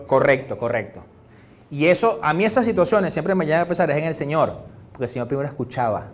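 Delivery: normal talking voice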